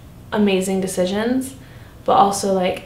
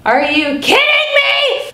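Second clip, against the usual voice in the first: high, loud, drawn-out disbelief; incredulous outburst; 5 dB louder, slower than usual. Retold or imitated. Telling how she reacted to the news, incredulous squeal. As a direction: squeal it in disbelief, stretching the words.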